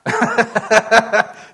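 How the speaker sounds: real laugh, high and loud; high burst of laughter. Real, not acted. Preacher laughs at his own point, loud and high.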